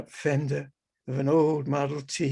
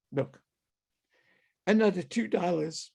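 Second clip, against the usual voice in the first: ordinary talk, nothing marked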